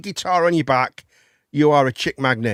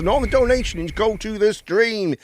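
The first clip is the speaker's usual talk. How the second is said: higher, sing-song stream announcement; announcer patter; 7 semitones higher than usual. Pretend. Host thanking Izzy T for stream donation, higher announcer patter. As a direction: reel it off like a chirpy announcer.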